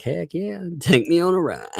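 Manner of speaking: high-pitched pretend excitement; playful high voice, acting thrilled; imagined eager passenger. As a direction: pipe up high like a keen passenger. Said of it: "You'd be like".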